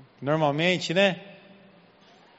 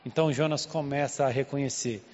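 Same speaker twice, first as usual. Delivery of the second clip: ordinary talk, nothing marked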